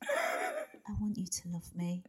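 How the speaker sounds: soft, slow, breathy recital. Pretend. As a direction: read it in a breathy hush. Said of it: "in this breathy voice".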